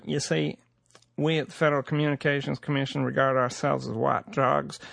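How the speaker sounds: usual speaking voice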